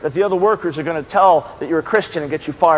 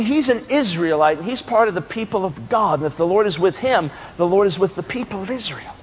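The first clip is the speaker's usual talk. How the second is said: ordinary talk, nothing marked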